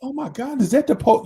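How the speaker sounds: high, startled mock panic; a touch of hammed-up fright; panicked women. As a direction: squeal it like you're caught.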